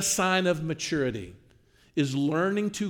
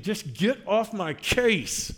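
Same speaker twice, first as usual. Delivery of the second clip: dismissive snap; 5 semitones higher, much slower than usual. Retold or imitated. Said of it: "instead of looking and saying".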